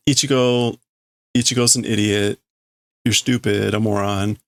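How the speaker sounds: ordinary talk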